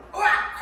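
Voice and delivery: high-pitched acted cry of outrage; sudden high "argh" in mock fury; mock angry yell. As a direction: burst out in an acted scream. Acts sudden outburst of anger.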